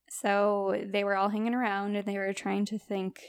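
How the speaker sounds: ordinary talk, nothing marked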